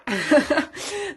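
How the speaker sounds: short burst of amused laughter. Real, not acted. Teacher amused by the sheep nearly fainting, short laugh. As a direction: laugh briefly at the silly puppet.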